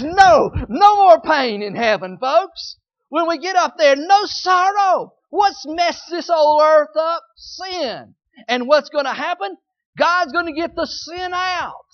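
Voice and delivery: full-force preaching. Acted. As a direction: proclaim heaven's promise to the church.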